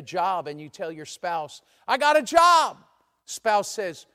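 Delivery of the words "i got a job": loud, high-pitched proud news; acted burst of excitement; excited announcement. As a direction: announce it to your spouse, hammed up. Acted spouse announcing a new job.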